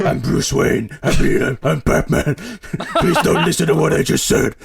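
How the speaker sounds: strained, rasping vigilante growl; comically hoarse superhero voice; Batman. Acted. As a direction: blurt it out in a gruff disguised voice, half laughing. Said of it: "It's the Batman voice".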